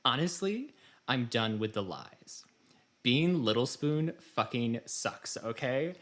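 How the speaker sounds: over-the-top indignant reading of a rant; dramatic reading. Shown as a demonstration. Host reading a Tumblr post to cohost, mock-dramatic rant. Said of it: "So I present you a dramatic reading".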